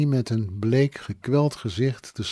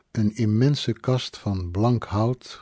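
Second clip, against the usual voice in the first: ordinary delivery, nothing marked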